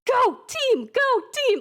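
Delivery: high, loud chanted cheer; crowd cheer. Acted. Townspeople clapping for the heroes, cheering. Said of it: "Like, clapping, just like".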